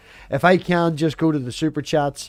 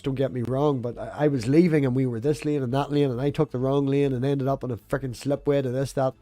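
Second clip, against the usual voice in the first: normal talking voice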